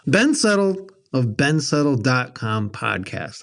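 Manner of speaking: slow, formal podcast intro read; professional announcer voice. Shown as a demonstration. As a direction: announce it like a radio host. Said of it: "How's that sound, Jonathan? ... more professional".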